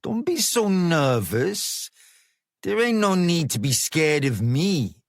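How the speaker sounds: a lightly voiced street boss, easy and sure of himself; a hint of a gang boss luring a boy into his car; smooth gang boss. A narrator's reading. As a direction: play the calm London hard man, coaxing. Narrator voices Business.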